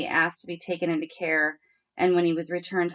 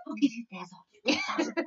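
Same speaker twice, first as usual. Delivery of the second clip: lightly voiced pleading little girl, softer; negotiating child; 4 semitones higher, 4 dB quieter, faster than usual. Sister bribing her crying sister, soft bargaining. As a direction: bargain softly like a guilty kid. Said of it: "kind of negotiating with Bailey".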